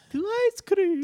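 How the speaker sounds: shrieky-high mock British lady; loud falsetto British woman; British woman accent. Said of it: "a woman with a British accent".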